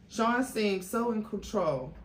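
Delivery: ordinary delivery, nothing marked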